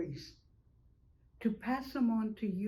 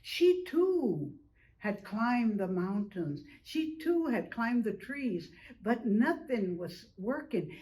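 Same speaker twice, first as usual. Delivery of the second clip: plain reading voice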